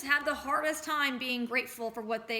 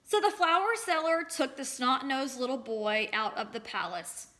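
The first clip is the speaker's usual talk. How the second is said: storyteller reading aloud to a class; story narration. A narrator's reading. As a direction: tell the folktale to the children.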